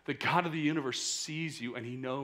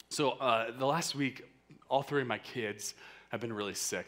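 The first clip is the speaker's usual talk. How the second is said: ordinary talk, nothing marked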